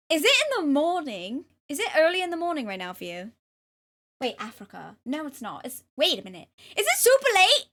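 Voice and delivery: lively put-on English accent; amateur English accent. Streamer questioning viewers during the redeem, mock English accent. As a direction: chat on in a playful, imperfect English accent. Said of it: "ten-minute English accent".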